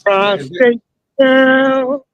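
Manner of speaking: slow, stretched sing-song label; drawn-out prostate girl. Pretend. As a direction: draw it out slowly, teasing.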